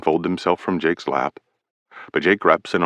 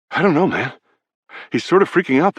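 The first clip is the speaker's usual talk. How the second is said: higher, uneasy teammate voice; anxious Brady; 10 semitones higher, faster than usual. A narrator's reading. Teammate Brady tells Carlson Teddy is freaking out, higher and uneasy. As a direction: shrug it off but worried.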